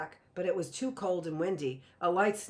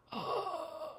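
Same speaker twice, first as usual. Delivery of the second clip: sharp gasp; 12 semitones higher, 7 dB quieter than usual. A narrator's reading. Judith reacts to confession.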